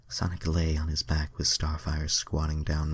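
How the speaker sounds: ordinary delivery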